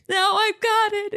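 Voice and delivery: put-on high, slow little-kid voice; little boy voice. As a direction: play a brave, scared little boy insisting. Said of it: "And he's like".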